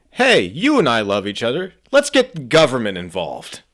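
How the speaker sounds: loud, high-pitched comic pitch; stand-up bit delivery. Acted. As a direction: belt out the bit to a crowd. Comedian performs own stand-up bit.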